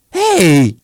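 loud, high cry of joy; joyful cry. A narrator's reading. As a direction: burst out loud and high with delight. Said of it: "mancò poco che dalla gioia". (grido di gioia)

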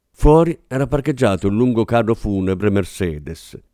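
ordinary talk, nothing marked

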